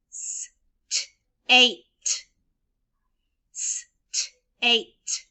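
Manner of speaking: "state" drawn out sound by sound; segmented sounds. Shown as a demonstration. Teacher stretching a word so children count its sounds. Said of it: "count the sounds in the word".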